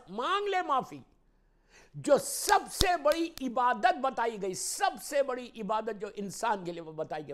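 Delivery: high, loud exhortation; ringing pulpit proclamation; impassioned preaching. Real, not acted. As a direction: urge the congregation at full voice. Preaches at full force.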